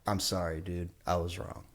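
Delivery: soft, low, sincere admission; calm apology. Retold or imitated. Man in the wrong soothes angry stranger, calm apology. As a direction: back down gently, quietly.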